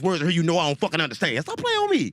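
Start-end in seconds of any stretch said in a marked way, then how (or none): 1.4-2.1 squeaky high indignant protest; mock outrage. Complaining to the Bible writers in a high mock-indignant squeal. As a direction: fling it up high like you're offended.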